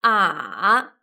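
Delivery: a dipping low then rising; third tone. Shown as a demonstration. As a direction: dip a down and bring it back up. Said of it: "The third tone is".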